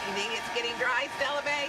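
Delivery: over hair dryer. Real, not acted. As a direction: talk up over the dryer noise. Hairdryer still running.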